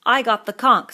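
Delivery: Piggy insisting on his right; the boy clutching the shell, demanding to be heard; indignant. A narrator's reading. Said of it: "said Piggy indignantly".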